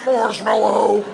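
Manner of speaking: high, slow, drawn-out mock plea; hammed-up agonized call; strained cry. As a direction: groan it out while being crushed. Strained cry while bear grinds him.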